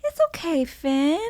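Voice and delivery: put-on little boy soothing. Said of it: "being like".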